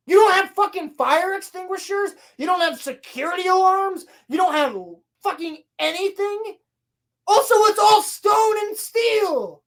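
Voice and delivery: high-pitched, indignant disbelief; outraged nitpicking; incredulous rant. Real, not acted. Incredulous rant at plot holes.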